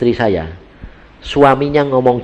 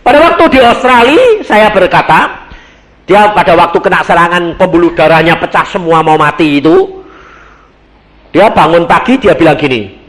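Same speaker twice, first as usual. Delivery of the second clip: loud, raised-voice testimony; forceful preaching; 6 semitones higher, 8 dB louder than usual. Real, not acted. Preacher tells of wife's illness in Australia, loud forceful preaching. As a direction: launch the story at full preaching force.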